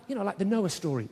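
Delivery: ordinary talk, nothing marked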